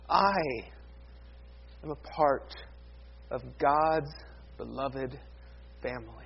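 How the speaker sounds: soft, low, word by word; hushed reverence. Real, not acted. Preacher assuring listeners they belong to God, hushed and reverent. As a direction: let it fade almost to nothing, with long pauses. Tender close after the shouted line.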